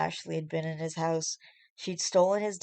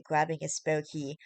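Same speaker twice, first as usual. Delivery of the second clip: ordinary delivery, nothing marked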